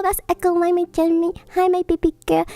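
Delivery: ordinary talk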